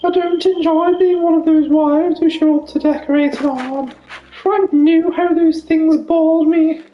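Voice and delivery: high posh lady voice. Pretend. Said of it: "do this voice for ages".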